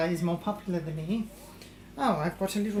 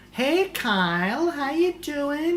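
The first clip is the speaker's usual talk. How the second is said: loud greeting; 6 semitones higher, 6 dB louder, slower than usual. Real, not acted. Greeting a newcomer loudly.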